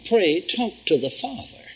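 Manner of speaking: usual speaking voice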